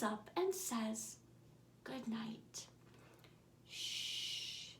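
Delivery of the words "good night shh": soft bedtime whisper, barely voiced. A narrator's reading. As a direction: hush them to sleep with a whisper.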